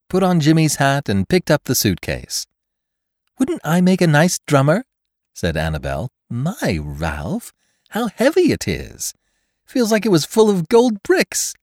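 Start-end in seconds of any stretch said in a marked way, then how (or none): 3.4-4.8 put-on high, light girlish voice; playful young woman. Annabel voiced playfully by narrator. 6.3-11.5 put-on high, light girlish voice; playful young woman. Annabel lifting the heavy suitcase.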